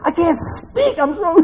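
high, choked-up words bursting into a wail; hammed-up crying too hard to speak; mock speechless sobbing. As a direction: imitate a loud blubbering breakdown. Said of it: "even when I can't say a word".

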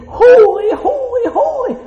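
high loud cry of holy; shouted holy. Pretend. Preacher voicing the creatures' cry, high and loud. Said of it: "these things say".

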